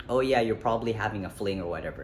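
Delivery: usual speaking voice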